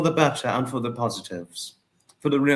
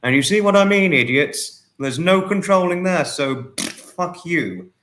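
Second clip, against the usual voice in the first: loud, raised angry rant at the idiots; angry outburst; 5 semitones higher, 5 dB louder than usual. Real, not acted. Venting at online idiots, loud angry outburst. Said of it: "I had to get that off my chest".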